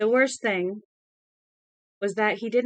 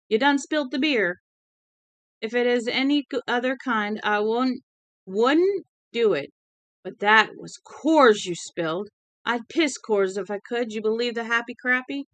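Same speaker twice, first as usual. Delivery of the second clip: raised, indignant Southern rant; drunken outrage; 4 semitones higher, 4 dB louder than usual. Acted. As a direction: scold like an outraged drunk, voice pitched up. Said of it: "His tone was one of a great outrage".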